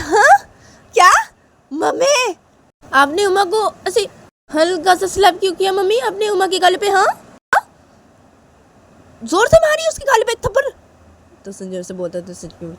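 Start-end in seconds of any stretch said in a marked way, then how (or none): 0.0-10.6 put-on high, loud childish complaint; acted aggrieved child; outraged protest. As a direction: demand fairness from your mother. Sanju protests light slap.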